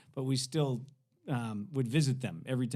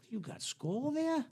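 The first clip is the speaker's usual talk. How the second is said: high, slow, curious question; imitated nosy hippie; 13 semitones higher, slower than usual. Hippie eyeing teen's dip tin, imitated curiosity. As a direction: draw it out, pitched well up.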